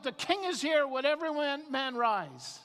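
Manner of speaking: high-pitched royal announcement; herald's shout. Acted. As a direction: proclaim it like a court herald. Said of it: "and he'd shout".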